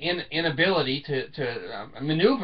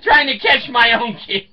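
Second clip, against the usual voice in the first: laughing; 7 semitones higher, 5 dB louder than usual. Real, not acted. Laughing at himself.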